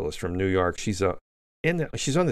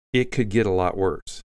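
ordinary talk, nothing marked

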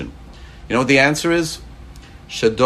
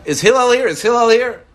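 high-pitched call, raised voice; 13 semitones higher, 4 dB louder than usual. Acted. Questioner pestering Hillel at his house, calling out high. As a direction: call out for someone in another room.

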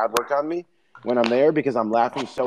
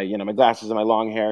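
ordinary talk

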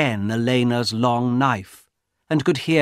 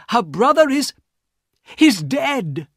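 much higher and louder, breathless alarm; a frightened passenger begging for help; urgent Mister Harris; 13 semitones higher, 4 dB louder than usual. Acted. Harris reports the death.

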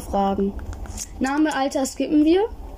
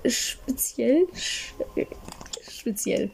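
suggestive hush; 7 dB quieter, much slower than usual. Pretend. Anzügliche Andeutung.

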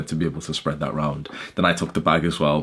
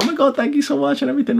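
very high, squealing thank-you; imitating a girl's high squeal of thanks; thrilled gushing; faster than usual. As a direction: gush like a delighted young woman. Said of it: "they're so happy".

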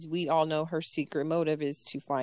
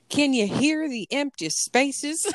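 high, slow Southern-belle question; 8 semitones higher than usual. Retold or imitated. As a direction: draw it out like a haughty movie belle. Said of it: "Southern belle".

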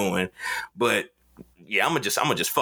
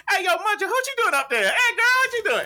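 calling out to a friend. Real, not acted. Stopping the fake panic to greet his college friend at the food truck, high laughing call. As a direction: shout a cheerful hello mid-meltdown.

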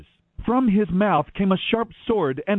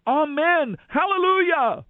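crying out praise; 9 semitones higher than usual. A narrator's reading. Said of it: "They cried out".

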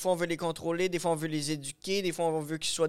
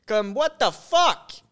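exasperated outburst; 5 semitones higher, 7 dB louder, much slower than usual. Acted.

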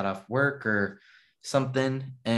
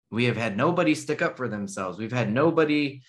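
normal talking voice

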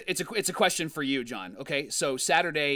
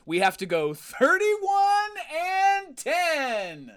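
high, slow mock sob; mock crying; 11 semitones higher, 4 dB louder, much slower than usual. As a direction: whimper the numbers out. Despairing over needed record.

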